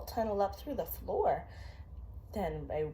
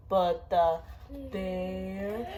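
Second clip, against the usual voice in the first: slow drawn-out refrain; much slower than usual. A narrator's reading. Parent reading a bedtime book to a child, slow drawn-out refrain. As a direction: bring it down slow and heavy like a sleeping bear.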